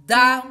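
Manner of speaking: да sung loud and accented; stress on first да. Shown as a demonstration. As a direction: hit the first да hard. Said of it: "На первое «да» у нас ударение".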